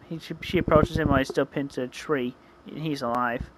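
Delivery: ordinary delivery, nothing marked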